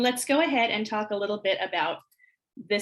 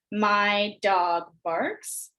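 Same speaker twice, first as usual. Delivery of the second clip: very slow, word by word; much slower than usual. Shown as a demonstration. Teacher counting words on fingers, very slow and spaced. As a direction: stretch it out, a pause after every word. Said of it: "put up a finger for each".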